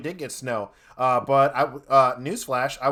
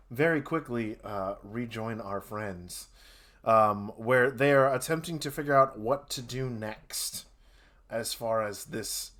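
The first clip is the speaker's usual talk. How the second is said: softer, slowing storyteller voice; game master narration; 4 semitones lower, 5 dB quieter, slower than usual. A narrator's reading. DM sets the scene for the players, soft narration. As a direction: draw them back into the tale quietly.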